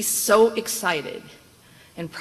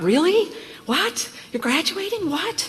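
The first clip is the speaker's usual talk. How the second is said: incredulous; 12 semitones higher, 3 dB louder, slower than usual. Retold or imitated. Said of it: "and I thought".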